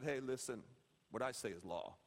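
low-pitched, quiet authority; deep, understated patriarch act; mock stern dad. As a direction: lay down the law in a low voice. Imagined stern dad laying down law.

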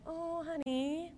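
slow, soft, pitying oh honey; God, tender. Retold or imitated. God looking at our self-confidence, slow and soft. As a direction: coo it like a patient parent. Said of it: "he's like".

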